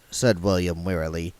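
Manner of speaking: plain reading voice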